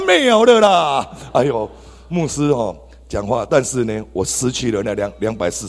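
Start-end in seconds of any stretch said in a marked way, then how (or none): 0.0-1.7 loud, high, slow drawn-out lament; rueful exclamation. Real, not acted. Telling the church about the lost money, slow loud lament. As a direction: stretch it out, mock-grieving the money.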